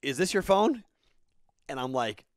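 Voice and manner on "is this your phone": loud, high shouted question; raised voice holding up the phone; called out. Retold or imitated. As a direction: shout it across to the driver. Employee holding up the found phone.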